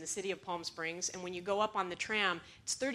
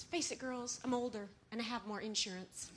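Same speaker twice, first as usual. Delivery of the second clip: softer, composed comeback; very calm; 7 dB quieter than usual. Acted. Older driver to the screaming girls, calm quiet retort. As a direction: drop the volume and answer unruffled. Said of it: "she just very calmly looks at them".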